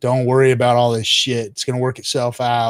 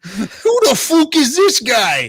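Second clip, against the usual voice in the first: 1 laughing disbelief; 10 semitones higher, 4 dB louder, slower than usual. Real, not acted. Laughing disbelief at chat moderator.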